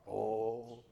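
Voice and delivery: low, soft, drawn-out "Oh"; a deep, moved "Oh". Retold or imitated. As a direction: sigh it out like the old man Melvin did. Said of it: "That's how he talked".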